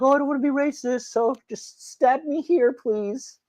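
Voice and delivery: high, thin-voiced pushover pleading; mock meek politician. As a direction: whimper it in a high mocking voice. Mocking spineless politicians who roll over.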